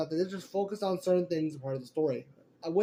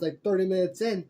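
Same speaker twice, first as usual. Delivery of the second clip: ordinary delivery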